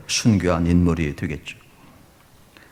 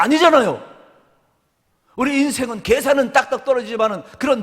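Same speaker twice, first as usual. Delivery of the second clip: high, loud preaching with rising fervour; full-force preaching; 12 semitones higher, 4 dB louder than usual. Acted. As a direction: preach it out to the congregation.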